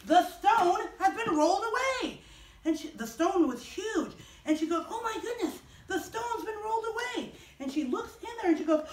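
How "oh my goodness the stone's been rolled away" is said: high-pitched astonished exclamation. Acted. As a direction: gasp it out in high-voiced disbelief. Mary finds the stone moved.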